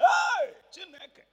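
high, loud cry of disbelief; shocked outcry. Retold or imitated. Preacher recalling refusals, crying out in disbelief.

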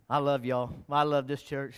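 softly telling the church he loves them; tender aside. Real, not acted. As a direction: tell them gently you love them.

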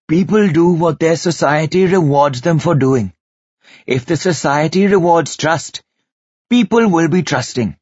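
an uncle handing down wisdom; voiced old guru. A narrator's reading. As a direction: preach it like a wise elder.

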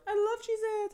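put-on high, excited child's cry; imitating kids cheering in a high voice; kids yelling. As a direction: squeal it like a little kid cheering. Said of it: "The kids would just be yelling".

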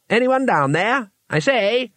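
high, drawn-out call to someone below. Acted. Two Flower calling from the cave high above, calling out.

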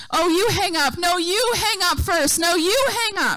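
hammed-up lover refusing to hang up; lovestruck dating couple. Dating couple on the phone, neither hanging up, playful mock-lovey. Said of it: "when you were dating".

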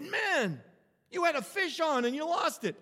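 high-pitched mock disbelief; retold amazement. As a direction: imitate an astonished fishing fan. Fellow angler's amazed reaction.

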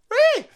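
very high, loud "Whee!" squeal. Real, not acted. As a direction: let out a gleeful squeak. Squeal cheering himself.

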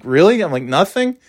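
high disbelieving rise; hammed-up disappointed disbelief; mock dismay. Dismayed at no reaction.

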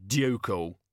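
plain reading voice

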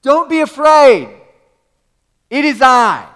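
loud, high, slow call across water. Acted. Jesus calling across the water, loud and slow. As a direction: call out over the storm.

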